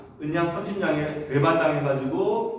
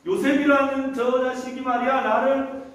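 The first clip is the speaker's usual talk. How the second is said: high-pitched, insistent mock complaint; wife crying out accusation; 7 semitones higher than usual. Master's wife accusing Joseph to the household, crying out. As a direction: wail the accusation to those who came. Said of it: "소리 막 지르니까".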